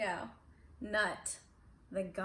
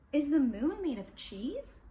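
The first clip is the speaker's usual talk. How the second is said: curious scientist; 5 semitones higher, 6 dB louder than usual. A narrator's reading.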